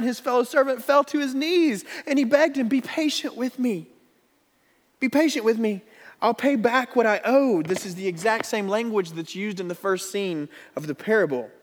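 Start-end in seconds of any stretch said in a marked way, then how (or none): 0.0-7.6 higher, pleading voice. Acted. Fellow servant on his knees, begging for patience. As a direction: beg for more time.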